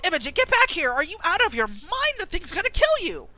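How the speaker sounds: high, urgent call; alarmed Danny. Acted. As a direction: warn her off in panic. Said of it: "and Danny says".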